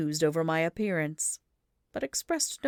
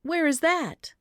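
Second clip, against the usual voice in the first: curious questioner; 11 semitones higher, 4 dB louder, slower than usual. A narrator's reading. Crowd asks.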